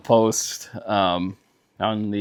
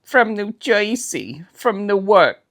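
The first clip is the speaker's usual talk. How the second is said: put-on thick Jersey accent; 10 semitones higher than usual. Friend showing the thick Newark accent. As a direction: stretch "Jersey" like a Newark local. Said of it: "the thick Jersey".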